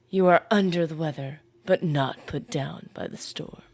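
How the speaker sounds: a lightly deepened, solemn inspirational read. Shown as a demonstration. Streamer rereads the get-well line in a mock inspirational voice. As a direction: deliver it like a mock-profound motivational speaker. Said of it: "Let me say it in my inspirational voice".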